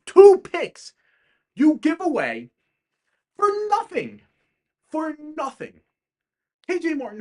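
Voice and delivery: loud, high angry tirade, yelling at the owner; real fury at a bad trade, voice climbing on Two picks; furious rant. Real, not acted. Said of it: "I'm incredibly pissed off at that deal".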